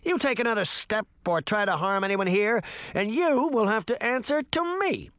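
put-on high-pitched, defiant boy's threat; brave warning. A narrator's reading. Puck warning the wolf off everyone here, brave. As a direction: warn the beast off boldly. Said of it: "Puck said bravely".

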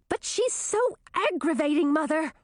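high whiny complaint; complaining. A narrator's reading. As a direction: whine it to mother. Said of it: "complained Chartreuse".

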